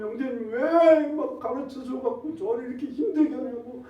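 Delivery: high, loud, anguished cry; retold shouting. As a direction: shout it as the worn-out disciple. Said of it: "소리소리를 질러가면". (괴로워 울부짖듯 소리치는 제자)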